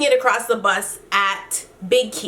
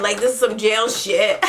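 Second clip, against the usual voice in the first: normal talking voice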